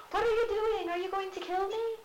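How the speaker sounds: high shrill mock cry for help; hammed-up frightened queen calling help; panicked queen.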